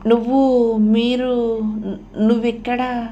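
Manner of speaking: halting, drawn-out broken question; shocked stammer. A narrator's reading. Archana stunned to find her friend here, shocked and halting. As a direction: stumble over the words in surprise.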